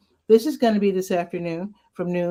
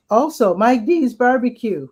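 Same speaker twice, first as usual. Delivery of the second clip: voice cracking; 5 semitones higher, 6 dB louder than usual. Real, not acted. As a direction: voice cracks on the words. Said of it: "Whoa, my voice cracked".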